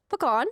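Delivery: "Pecan" with a weak first syllable and a long AH; "Pecan" rhyming with "upon"; puh-KAHN pecan. Shown as a demonstration. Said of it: "sound like a little bird".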